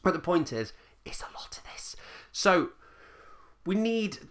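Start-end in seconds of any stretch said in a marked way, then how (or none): 1.0-2.0 breathy whispered aside. Acted. Vlogger whispering an aside to viewers, hushed. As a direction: drop to a secret whisper.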